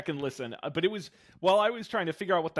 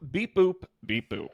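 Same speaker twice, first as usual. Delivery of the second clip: R2-D2 beeps; 3 semitones higher, slower than usual. Retold or imitated. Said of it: "In the words of the immortal R two D two".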